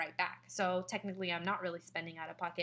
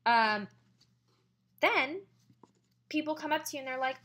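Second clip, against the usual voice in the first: ordinary talk, nothing marked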